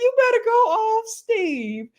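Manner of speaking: very high squeal of encouragement; excited cheering. Real, not acted. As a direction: cheer him on, pitch way up. Cheering on Steve.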